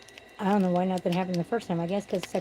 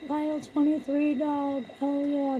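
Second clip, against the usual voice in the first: higher hammed-up lustful cry; mock sexy shouting; 7 semitones higher, slower than usual. Showing how she called his name, mock lustful higher cry. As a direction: ham up the bedroom moan. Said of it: "Like that".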